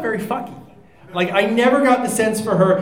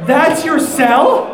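incredulous challenge; 6 semitones higher, 4 dB louder than usual. Real, not acted. Incredulous challenge in a heated debate.

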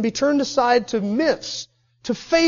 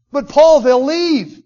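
loud, drawn-out objection; protesting Timothy; 3 semitones higher, 7 dB louder, slower than usual. Acted. Timothy objecting to Paul's order, loud drawn-out protest. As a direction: protest to your mentor, slow and loud.

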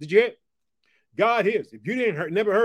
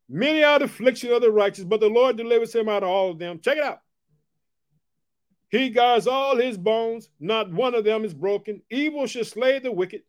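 raised, ringing scripture reading; scripture proclamation; 4 semitones higher, 3 dB louder than usual. Acted. Preacher reading the psalm to his viewers, ringing and raised. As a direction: proclaim each phrase to the congregation.

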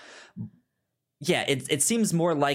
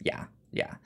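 whispered yeah; 7 dB quieter than usual. Real, not acted. Quiet aside agreeing.

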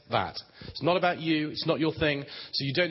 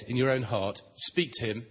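ordinary talk

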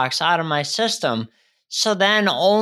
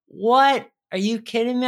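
retold jolt of surprise, pitch jumping higher; a patient's half-acted gasp of shock; shocked disbelief; 5 semitones higher than usual. Retelling the doctor's injection news, mock stunned disbelief. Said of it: "my mouth hit the floor".

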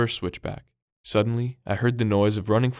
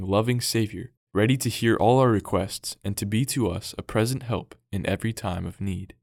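plain reading voice

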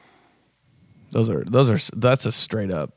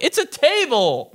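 exasperated. Retold or imitated. Arguing with mom about coasters.

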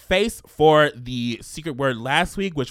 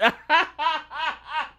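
high burst of laughter. Real, not acted. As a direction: laugh it off, embarrassed. Laughs after apology.

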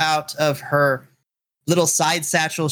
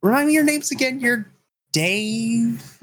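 put-on higher, eager little girl asking names; ecstatic girl; 7 semitones higher than usual. Young girl to the party, mildly ecstatic. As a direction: bubble over, a bit higher. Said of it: "gets ecstatic".

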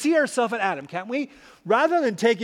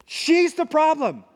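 higher, slower, finger-pointing accusation; accusing; 8 semitones higher, 4 dB louder, slower than usual. Retold or imitated. Adam blaming the woman, higher and slow. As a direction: pin it all on her. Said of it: "Adam has changed his tune".

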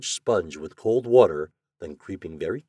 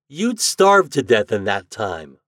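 ordinary delivery, nothing marked

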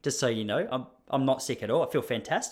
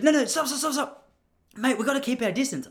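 alarmed postman; 11 semitones higher than usual. Retold or imitated. Postman telling the narrator to keep away, in a high panicky yelp. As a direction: mimic a jumpy postman waving him off. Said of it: "He goes".

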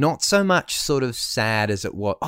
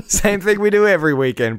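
laughing; 10 semitones higher, 6 dB louder than usual. Real, not acted. Laughing joke.

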